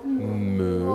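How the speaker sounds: "Me" sounded out by itself, drawn out; low, held teacher's "Me"; isolated syllable. Shown as a demonstration. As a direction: sound out "Me" for beginning spellers. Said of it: "Première syllabe".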